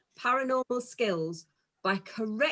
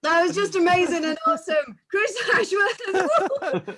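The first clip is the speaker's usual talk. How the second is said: excited gushing; 8 semitones higher, 6 dB louder than usual. Real, not acted. Host gushing after comedy act.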